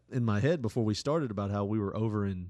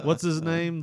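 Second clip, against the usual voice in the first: usual speaking voice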